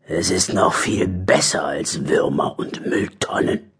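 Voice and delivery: low, hushed character voice; secretive badger voice. Harry the badger grins over his find, low secretive voice. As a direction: let it out low, almost whispered.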